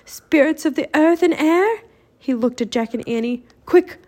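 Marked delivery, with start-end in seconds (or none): 0.0-1.8 high, ringing spell-caster's call; raised-voice magical invocation; incanting a rhyme. A narrator's reading. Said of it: "started a rhyme". 3.6-4.0 high, loud, sudden urging; urgent raised-voice command; urgent prompt. Acted. Teddy urging friends for a rhyme.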